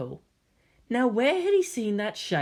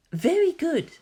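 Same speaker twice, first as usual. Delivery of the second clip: gentle praise; 11 semitones higher, slower than usual. A narrator's reading. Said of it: "same gentle tone".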